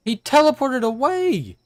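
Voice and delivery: loud, high, outraged shouting. Real, not acted. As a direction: yell in shocked disbelief. Outburst as enemy teleports.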